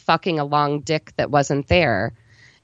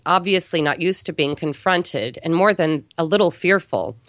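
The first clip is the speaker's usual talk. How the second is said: ordinary delivery, nothing marked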